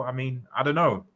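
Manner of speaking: ordinary talk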